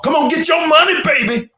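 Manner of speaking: high, loud promoter's holler; hype-man trash talker. Acted. Fighter who likes to talk promoting a bout, loud high hype. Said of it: "a guy that likes to promote, that likes to talk".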